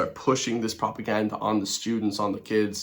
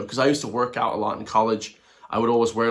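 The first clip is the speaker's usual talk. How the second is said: normal talking voice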